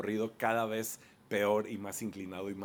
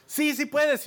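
cheering encouragement; 6 dB louder, slower than usual. Retold or imitated. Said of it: "Te van motivando así".

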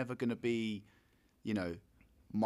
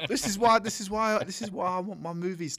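exasperated outburst; 6 semitones higher, 5 dB louder than usual. Real, not acted. Exasperated outburst about movies.

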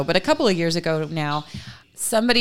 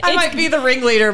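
louder and higher, laughing through it; 5 semitones higher, 6 dB louder, faster than usual. Real, not acted. Host laughing at not knowing the topic. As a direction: laugh the words out, amused at yourself. Host admits she is lost.

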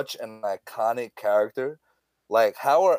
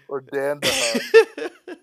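slow, laughing high; 11 semitones higher, 5 dB louder, much slower than usual. Real, not acted. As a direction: drag it out through laughter. Mixing up names, joking.

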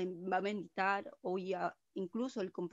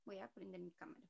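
faint, distant phone voice; speaking far from the microphone; far from mic; 12 dB quieter than usual. Real, not acted. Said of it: "se te escucha bien lejos".

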